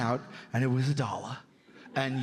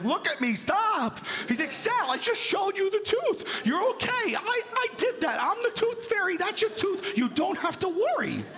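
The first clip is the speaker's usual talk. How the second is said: much higher, frantic pleading dad voice; hammed-up frantic dad; 14 semitones higher than usual. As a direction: play the dad desperately calming his kid.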